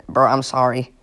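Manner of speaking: ordinary talk, nothing marked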